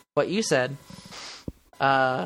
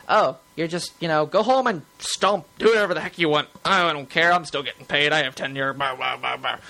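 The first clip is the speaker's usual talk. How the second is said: loud, dismissive mock teacher; lazy uncaring teacher; 4 dB louder than usual. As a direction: brush it all off like a bored teacher. Mocking careless tenured teachers.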